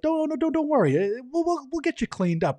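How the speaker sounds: very high, fussing imitation; flustered reassurance. Woman promises to clean up the worried butcher, flustered reassurance.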